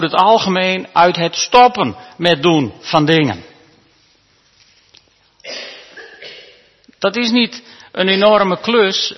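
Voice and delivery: loud, emphatic pulpit delivery; preacher calling out to the church; preaching at full force. Acted. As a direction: drive the point home to the congregation. Preacher raises voice to congregation.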